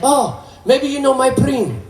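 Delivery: put-on eager Portuguese accent. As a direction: play an eager Portuguese uncle with a thick accent. Fan asks about his cousin.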